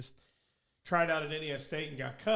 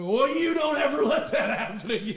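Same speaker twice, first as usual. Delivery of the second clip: higher, louder laughing scold; mock scolding with a laugh; 9 semitones higher, 8 dB louder than usual. Teammate scolding the pitcher while laughing, louder. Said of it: "And I'm like".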